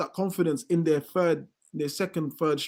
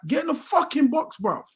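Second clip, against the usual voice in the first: mock stand shout; 8 semitones higher than usual. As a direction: bark it at him like a fan on the terrace. Yelling at striker from stands.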